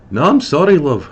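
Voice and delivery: high, loud dwarf voice; old dwarf voice. Acted. Old dwarf Scosset answers the large man, high and loud. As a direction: pitch it up and belt it like an old dwarf.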